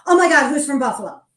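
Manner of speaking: excited. Retold or imitated. Guy excited to spot Bills flag.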